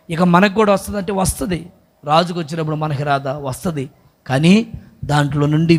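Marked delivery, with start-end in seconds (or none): none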